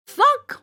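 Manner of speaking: high sharp thunk of an axe. A narrator's reading. Narrator voicing the axe hitting the tree. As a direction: make the axe bite the wood.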